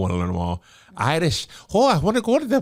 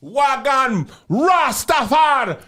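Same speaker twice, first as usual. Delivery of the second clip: slow Jamaican drawl; stretched Jamaican words; Jamaican accent; 3 semitones higher, much slower than usual. Pretend. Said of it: "[name], do a Jamaican accent".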